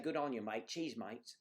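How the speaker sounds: put-on caricatured Australian accent; hammed-up Aussie greeting; exaggerated Australian. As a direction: play up a cartoon Australian accent. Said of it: "I know it's an exaggeration".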